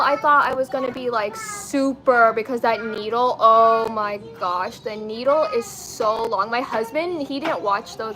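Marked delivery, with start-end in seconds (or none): none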